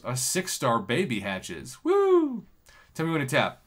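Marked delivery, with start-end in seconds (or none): 1.8-2.4 high, gleeful cheer; excited whoop. Real, not acted. Cheering as a six-star hatches, a high whoop. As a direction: whoop with joy.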